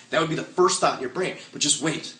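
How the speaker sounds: ordinary talk, nothing marked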